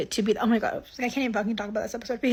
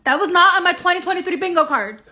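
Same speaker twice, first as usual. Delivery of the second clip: higher and louder, thrown up in disbelief; 6 semitones higher, 6 dB louder than usual. Real, not acted. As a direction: exclaim in shock. Reacting to the twist.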